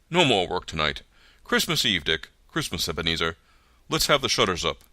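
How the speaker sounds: rally the lads; jovial cry. A narrator's reading. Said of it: "cried old Fezziwig".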